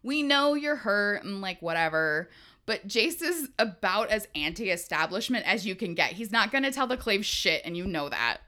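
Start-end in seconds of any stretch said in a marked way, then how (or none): none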